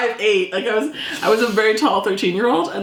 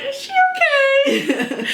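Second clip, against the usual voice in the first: worried staff; slower than usual. Retold or imitated. Staff worried about crying girl.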